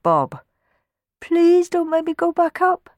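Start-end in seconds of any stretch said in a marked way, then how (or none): 1.2-2.9 put-on higher, louder pleading child voice; begging boy. Sammy begging the children again, higher pleading. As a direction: beg desperately like a frightened child. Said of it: "Sammy repeated".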